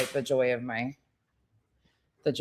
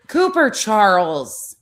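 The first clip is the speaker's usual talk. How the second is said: slow, louder warning name; stern scolding; 5 semitones higher, 7 dB louder, slower than usual. Real, not acted. Scolding dog by full name.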